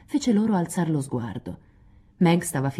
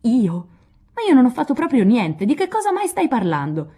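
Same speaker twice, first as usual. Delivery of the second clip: higher, louder stunned denial; astonished; 7 semitones higher, 5 dB louder than usual. Acted. Jo denying to Meg she wrote it, astonished. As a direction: blurt it out, utterly baffled. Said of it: "esclamò Joe sbalordita".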